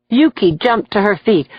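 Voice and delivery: ordinary delivery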